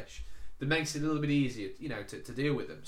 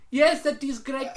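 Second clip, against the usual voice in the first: imitated Indian accent; 11 semitones higher, 7 dB louder than usual. Agent voiced in phone story.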